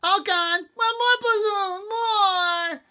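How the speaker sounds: very high, loud wailing demand, slow; screaming toddler. Acted. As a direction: wail for another balloon. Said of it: "And he screamed".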